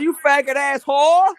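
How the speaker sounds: high, slow mocking Baltimore accent; Baltimore lingo. Imitating the Baltimore rapper's insult in Baltimore accent. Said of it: "he said".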